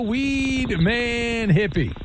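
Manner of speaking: slow hyped announcer call; announcer intro. Acted. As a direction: drag the name out for the crowd. Host repeats intro after hello.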